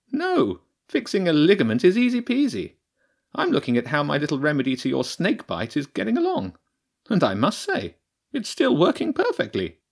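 high, self-satisfied badger voice; pleased badger priestess. The badger priestess pleased with her own remedy, high and smug. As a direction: boast about your remedy, high and bright.

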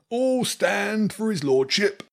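high, slow, pompous herald call; herald announcing. A narrator's reading. Rat herald announcing his lordship again. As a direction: proclaim it grandly like a herald. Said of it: "blew the trumpet again".

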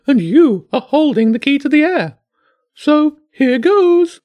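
high, loud bird voice musing aloud; high cartoon bird, slowing on the last words; pompous pigeon voice.

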